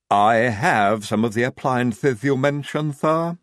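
stiffly. Acted. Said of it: "said Igorina stiffly".

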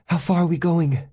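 high woman's voice, asking; high-pitched female character question; Janet's voice. Acted.

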